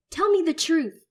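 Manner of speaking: loud, high snarled demand. Acted. Son squaring up to his mother, snarling. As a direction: snarl it in her face. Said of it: "I snarl".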